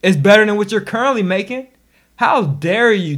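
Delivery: indignant loud rebuttal; loud retort. Real, not acted. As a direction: shoot back at the doubters. Retort to skeptic.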